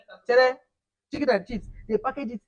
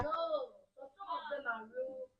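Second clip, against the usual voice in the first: soft fake sob, pitched up; mock crying; 7 semitones higher, 7 dB quieter than usual. Speaker pretending to weep while remembering real potatoes, in a mock sob. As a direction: whimper as if heartbroken, playfully.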